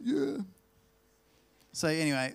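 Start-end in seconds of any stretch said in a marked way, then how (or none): 0.0-0.4 high rising broad Australian yeah; a high broad Aussie yeah. Retold or imitated. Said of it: "that's what they sound like".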